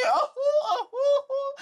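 very high laughing fake sobs; mock sobbing. Imitating Sarah crying over Natalie, very high mock sobs. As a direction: whimper high like a fake crier. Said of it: "She said".

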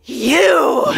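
loud, drawn-out exclamation; angry snarl turning broad grin. Acted. Dragonborn spotting an old friend, loud snarl. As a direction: boom it out on recognizing someone. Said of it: "the snarl that is immediately angry".

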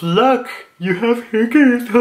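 high, drawn-out mocking voice; imitated teasing friend. The friend showing a phone photo, high teasing crow.

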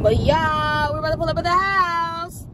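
high, drawn-out arrival cheer; excited announcement. Real, not acted. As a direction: announce it excitedly, stretching the words out.

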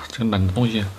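ordinary talk, nothing marked

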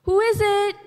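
high loud yell. Acted. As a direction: shout it through the door. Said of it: "yelled the second little pig".

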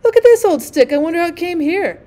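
put-on high, loud boyish exclamation; excited boy. A narrator's reading. Boy finding the rocket, high and excited. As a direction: pipe up at a surprising find.

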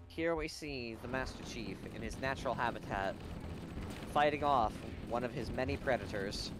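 lightly hushed nature documentary narration; a hint of wildlife narrator; David Attenborough voice. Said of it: "I should've put the full-on David Attenborough voice".

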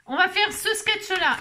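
high cheerful let's go; excited restart. Real, not acted. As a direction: bounce back brightly to start. Restarting cheerfully after rant.

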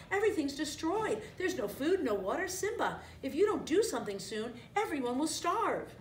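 high, pleading lioness voice; urgent lioness. A narrator's reading. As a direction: warn Simba and urge action. Nala urgently pleads with Simba.

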